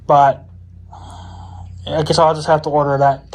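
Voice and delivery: ordinary delivery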